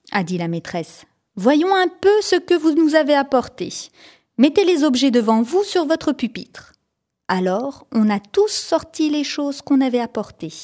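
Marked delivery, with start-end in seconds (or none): 1.4-6.7 a touch higher, schoolmistress addressing her pupils; lightly voiced teacher taking charge of the class; the teacher asks the pupils to show their things. A narrator's reading.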